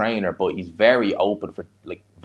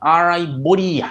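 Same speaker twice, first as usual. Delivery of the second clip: hammed-up rough Dublin accent, higher and louder; mock a rough Ballymun commentator, loud and drawn out; imitation rough Dublin fight commentary; 6 semitones higher, 3 dB louder, slower than usual. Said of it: "it's a rough accent".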